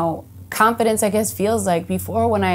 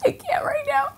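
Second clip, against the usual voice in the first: tearful refusal; 6 dB quieter than usual. Retold or imitated. Crying on phone, refusing fan.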